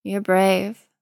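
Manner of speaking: understated wry surprise; half-surprised, half-wry. A narrator's reading. Jason appraises Tim, half surprised and half wry. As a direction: say it wryly, a touch taken aback. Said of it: "in a half-surprised, half-wry tone".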